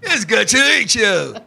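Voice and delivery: halting dubbed martial-arts greeting; slow, stilted kung-fu film delivery with long pauses; mock kung-fu movie voice. Boyfriend greeting girlfriend's father, mock kung-fu voice. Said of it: "I went up to him, I was like".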